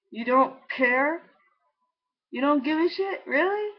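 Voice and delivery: high rising disbelieving questions; retold incredulity. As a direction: re-enact being stunned, pitch rising. Disbelief at mom's reply, retold.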